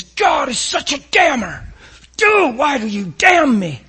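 loud, high, slow, indignant shout at God; hammed-up outrage aimed upward; mock angry accusation. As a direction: blast it like a wronged man.

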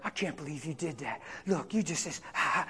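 low, soft condemning hush; accusing whisper. Acted. Enemy condemning after the sin, low and hushed. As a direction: softly shame them, low. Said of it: "that voice is right there".

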